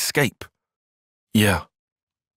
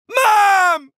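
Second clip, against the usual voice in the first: urgent shout; 5 dB louder than usual. Acted. Calling out urgently in game.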